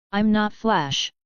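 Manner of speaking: slow flat deadpan. A narrator's reading. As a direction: say it flatly, no emotion. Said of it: "X L R eight said flatly".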